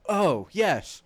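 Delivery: sudden bright remembering, played for laughs; mock Frodo. Friend retelling the movie, voicing Frodo as a mock realization.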